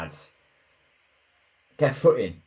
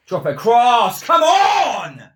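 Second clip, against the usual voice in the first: much higher, much louder yelled plea; frantic shout; 13 semitones higher, 10 dB louder, slower than usual. Real, not acted. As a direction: yell it at the screen as the chance builds. Urging player during attack.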